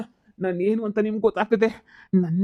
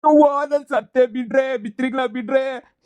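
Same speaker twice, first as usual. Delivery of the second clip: pained wail; 5 semitones higher, 4 dB louder, slower than usual. Acted. Husband wailing under the blows.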